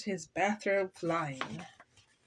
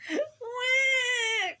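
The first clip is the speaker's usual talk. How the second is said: long, high imitated baby cry; storybook baby's "wah"; baby ghost wail. A narrator's reading. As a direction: wail like a hungry infant on one held note. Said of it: "cried baby ghost".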